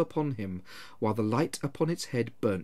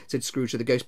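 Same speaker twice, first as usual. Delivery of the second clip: plain reading voice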